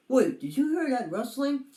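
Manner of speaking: hammed-up nervous turtle; alarmed. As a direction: freeze at a sudden rustle. Turtle hears a noise.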